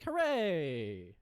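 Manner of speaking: loud, sarcastic little cheer; mock cheer. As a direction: cheer it like a fake celebration. Mock cheer when she finally acts.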